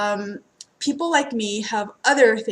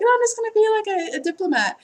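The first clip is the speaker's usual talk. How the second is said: put-on naive child self; 11 semitones higher, 3 dB louder, faster than usual. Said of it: "I was like".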